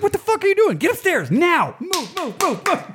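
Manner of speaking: high mock yell, pitched far up; retold yell. Bandmate retells yelling at kids, high mock yell. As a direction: shout the kids upstairs again.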